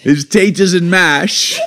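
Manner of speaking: high, drawn-out mock London accent through laughter. Parent defending a London impression mistaken for Irish, silly London accent. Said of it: "Can you do your best London accent?".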